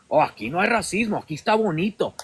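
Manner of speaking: normal talking voice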